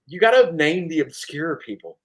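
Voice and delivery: ordinary delivery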